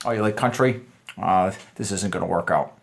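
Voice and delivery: a touch of earnest disappointment, low; too serious. Shown as a demonstration. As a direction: sound genuinely let down. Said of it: "You're not gonna be like that".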